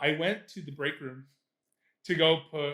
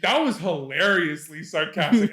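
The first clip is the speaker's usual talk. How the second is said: louder, with HILARIOUSLY leaned on; emphatic HILARIOUSLY, louder than the rest; self-congratulating thought; 2 semitones higher, 6 dB louder than usual. Retold or imitated. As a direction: punch the word hilariously, pleased with yourself. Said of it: "I'm thinking this to myself".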